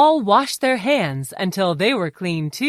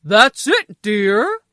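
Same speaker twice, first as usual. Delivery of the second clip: proud; 2 semitones higher, 6 dB louder, slower than usual. A narrator's reading. Mister Smacklips applauds his wife, proud character voice. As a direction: praise your wife proudly. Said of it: "said Mister Smacklips proudly".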